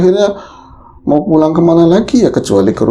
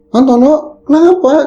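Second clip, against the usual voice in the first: startled call; 12 semitones higher than usual. Retold or imitated. Startled man calls out.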